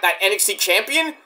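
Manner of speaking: ordinary talk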